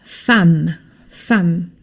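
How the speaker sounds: "femme" said fam, with an open a. Shown as a demonstration. Teacher showing the class "femme" said fam. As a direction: say "femme" as fam, never with an e sound. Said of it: "and it's pronounced".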